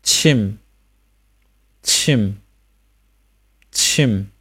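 "침" said as chim, ee vowel into m; isolated careful "침", falling tone; final m demonstration. Shown as a demonstration. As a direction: puff the ch, seal lips to end "침". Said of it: "气加收音".

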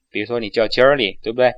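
usual speaking voice

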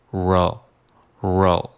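"roll" from curled tongue to upper ridge; slow "roll", isolating the linking sound. Shown as a demonstration. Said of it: "你就会发出一个". (卷舌连读音)

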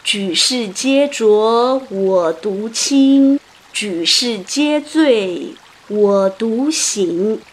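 very slow, drawn-out classical line; solemn recitation. A narrator's reading. Qu Yuan answers the fisherman, solemn slow recitation. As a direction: declaim it slowly, word by word.

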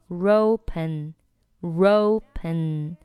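"ropen" as one slow word; drawn-out model of "ropen"; r linked forward. Shown as a demonstration. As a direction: attach the r to open, "ropen". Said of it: "这个er和open又连".

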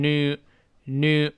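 new with the y dropped, "noo"; American new. Shown as a demonstration. Teacher repeats the US form for learners, new as "noo". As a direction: drop the glide and say new as "noo". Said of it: "多数情况下去掉".

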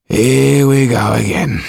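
slow, loud, put-upon coachman; weary grumble. Acted. Dufour grumbles about his mistress, slow weary voice. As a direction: drag it out, fed up. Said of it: "he muttered".

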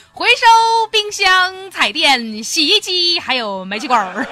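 slow, drawn-out street hawker call, loud and high; loudspeaker recycling call. Retold or imitated. Borrowed loudspeaker plays a junk collector's recording, drawn-out hawker call. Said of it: "喇叭里面传出来一阵声音".

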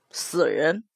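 low, soft ominous voice; low man's voice. Acted. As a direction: drop it low and grim. Mo Jie.

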